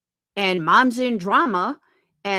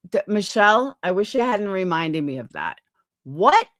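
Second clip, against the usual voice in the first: ordinary delivery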